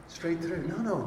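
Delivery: usual speaking voice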